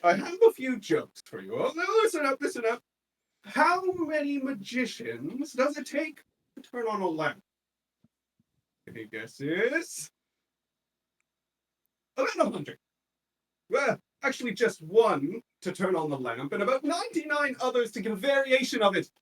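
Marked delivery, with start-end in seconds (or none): 0.0-7.4 high, loud hammy stand-up voice; comedian's patter. Acted. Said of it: "he says". 12.2-19.1 high, loud comic punchline. Acted. Comic delivers punchline to crowd.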